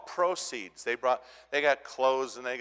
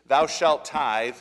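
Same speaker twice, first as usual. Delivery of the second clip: slow, louder mock commandment; emphatic decree; 2 semitones higher, 7 dB louder, much slower than usual. Pastor mimics websites that preach tithing, emphatic slow decree. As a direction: pronounce it like scripture law. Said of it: "emphatic and teaches".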